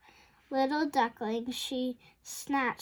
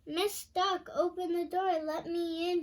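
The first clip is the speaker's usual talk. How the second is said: high, pleading mock howl; 6 semitones higher, faster than usual. A narrator's reading. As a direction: howl at the shut door, lightly. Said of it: "he howls".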